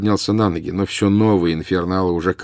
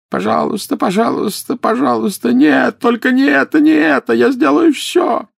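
put-on high, desperate old man begging; anguished pleading cry; 5 dB louder than usual. A narrator's reading. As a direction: beg in pain, voice cracking high. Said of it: "опять закричал". (отчаянная мольба старика)

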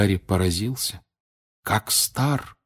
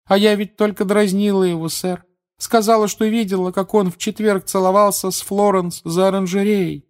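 put-on high, loud girl's voice; pitched-up schoolgirl tattling; complaining teenage girl; 12 semitones higher, 5 dB louder than usual. A narrator's reading. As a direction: tell on someone to the professor, high and loud. Aggrieved girl figure keeps complaining.

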